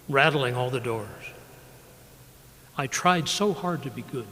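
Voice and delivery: measured, unhurried poem recital; poetry reading. Acted. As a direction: let each line land, then pause. Poet reads his poems.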